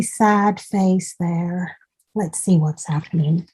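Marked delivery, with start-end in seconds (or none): none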